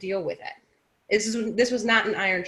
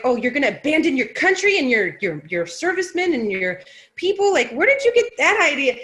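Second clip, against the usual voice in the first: louder, higher mocking of an indignant critic; mock outraged commenter; 5 semitones higher, 4 dB louder than usual. Woman mimics hostile commenters with mock outrage. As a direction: sneer it like an offended internet stranger. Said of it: "but then they're like".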